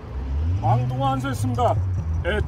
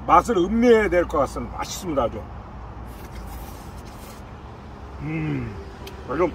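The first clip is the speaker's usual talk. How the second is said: speaking between bites; chewing while talking; 8 semitones lower, 5 dB quieter, faster than usual. Real, not acted. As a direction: mouth partly full of noodles. Seen 1: Slurped noodles just before; head down, mouth working; face leaves frame later.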